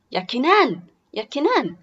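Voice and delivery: loud motherly call for her son. Acted. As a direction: call the boy from another room.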